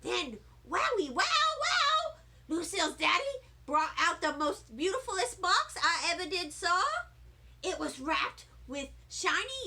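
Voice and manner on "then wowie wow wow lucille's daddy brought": wow shot up high and loud; thrilled. Acted. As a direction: gasp with delight at the present. Narrator thrilled by present.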